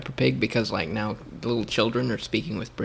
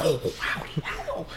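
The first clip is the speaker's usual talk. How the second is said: mock thrilled echo, lifting higher; imitated British delight at howdy; 5 semitones higher, 3 dB quieter than usual. Imitating British guests who love Texas accents, mock thrilled. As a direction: imitate wedding guests delighted by Southern talk. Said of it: "They'd be like".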